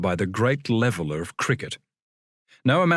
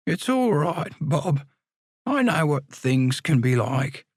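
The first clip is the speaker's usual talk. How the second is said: character voice; 4 semitones higher, slower than usual. Acted. Bob voiced in narrated story.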